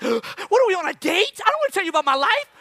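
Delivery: high-pitched comic indignation; exasperated retort. Retold or imitated. Winded runner to nosy neighbor, mock indignant. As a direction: fire back in a high, incredulous voice. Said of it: "And I'm like".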